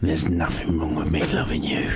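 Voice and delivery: low, creepy whispered lyric. Pretend. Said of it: "speak those lyrics in a creepy voice".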